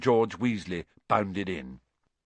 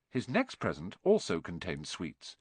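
ordinary delivery

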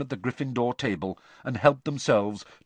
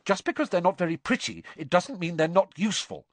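higher, quick irritated retort; snapping; 9 semitones higher, faster than usual. Acted. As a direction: snap back at him. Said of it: "Hermione snapped".

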